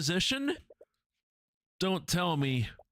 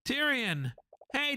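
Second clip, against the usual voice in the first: ordinary delivery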